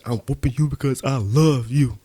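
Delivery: stern mom voice, loud; mom's whooping line. Retold or imitated. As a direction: play the stern mother who whoops out of love. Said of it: "She said".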